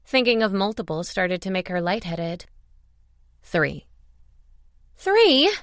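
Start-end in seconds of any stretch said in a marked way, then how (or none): 3.4-3.9 low, matter-of-fact hero voice; male lead's answer. A narrator's reading. Hero gives the number. 5.0-5.5 very high, loud, incredulous question; shocked echo. A narrator's reading. Heroine shocked by the number.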